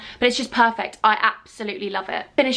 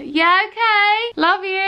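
higher, slow, sweet; sugary cover-up; 8 semitones higher, slower than usual. Real, not acted. Answering husband while hiding the spill, sweet and innocent.

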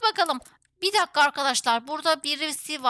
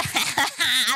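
low amused laugh; 5 semitones lower than usual. Real, not acted. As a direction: laugh out after a successful prank. Laughs after robbing the safe. (keyifli kahkaha)

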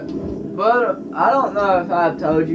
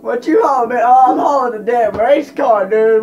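mock trucker; 6 semitones higher, 5 dB louder than usual. Acting out trucker exchange.

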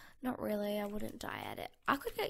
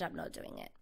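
whispered aside; 11 dB quieter than usual. Real, not acted. Gamer confessing to viewers, whispered self-mockery. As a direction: voice drops to a breathy whisper.

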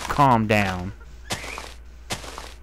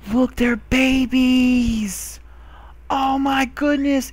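much higher, slow and gushing; excited gasp; 11 semitones higher, much slower than usual. Real, not acted. Finding baby dinosaurs, a high gushing gasp of delight.

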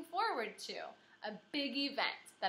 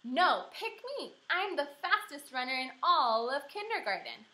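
put-on hollering girl; 5 semitones higher, 6 dB louder than usual. A narrator's reading. Grace hollering at the teacher to pick her. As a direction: holler to be picked captain. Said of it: "hollered that Grace".

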